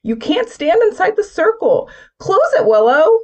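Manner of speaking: urgent shout. Acted. Stormy yelling at Mark and Willow in panic. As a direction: yell it in panic.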